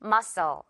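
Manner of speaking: "Muscle" as MUH-sul, no k; "Muscle" in two careful syllables, the c silent; model pronunciation. Shown as a demonstration. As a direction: model "Muscle" for repeating, falling at the end. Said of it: "One more time".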